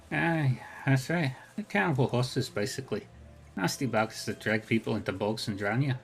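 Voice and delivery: lilting Irish brogue, easygoing; a friendly Irish arcade regular, talked lightly; lyrical Irish accent. Acted. GM voices Rainbow.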